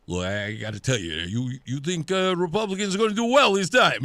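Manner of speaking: bad Rocky-like Italian-guy accent, climbing in pitch; horrible Italian accent. Host mimicking his holiday friend John, bad Italian accent. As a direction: imitate an Italian pal with a hammed-up accent. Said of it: "I'm doing a very horrible Italian accent".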